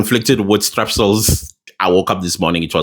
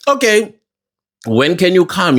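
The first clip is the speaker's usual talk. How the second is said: higher-pitched retold question; imitated questioner; 6 semitones higher than usual. Commission asking the witness for a new date, higher imitated questioner.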